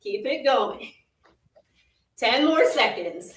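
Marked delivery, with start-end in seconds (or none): none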